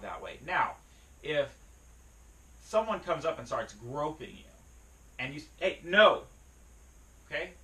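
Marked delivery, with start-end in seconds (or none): 5.6-6.2 loud, raised-pitch warning shout; sharp warning tone. Shown as a demonstration. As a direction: fend off someone groping you. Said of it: "That's the time to use that tone of voice".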